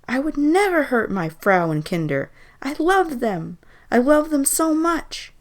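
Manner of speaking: high, loud pleading; desperate protest. A narrator's reading.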